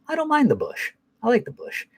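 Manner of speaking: high, soft put-on voice; imitated pops. Pops admitting his preference to his son, high soft imitation. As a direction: lift it way up high and keep it soft. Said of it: "and Bob's like".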